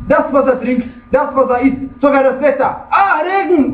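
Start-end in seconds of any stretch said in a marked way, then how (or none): 2.9-3.7 mock grumble in a higher pitch; high-pitched mock exasperation; grumbling complaint. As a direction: imitate someone moaning about the weather. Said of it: "und dann schimpft er".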